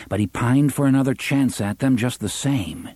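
usual speaking voice

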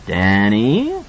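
a lightly voiced cold coaxing; coldly wheedling. A narrator's reading. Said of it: "his father's voice came coldly wheedling".

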